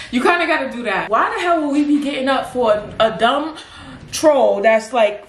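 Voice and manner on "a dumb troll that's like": ordinary delivery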